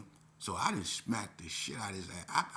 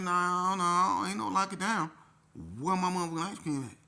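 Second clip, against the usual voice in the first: loud, high, defiant shout; angry refusal; 10 semitones higher, 9 dB louder than usual. Retold or imitated. Youth refusing lockdown over ice cream, angry shout. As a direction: shout the refusal back at the guard.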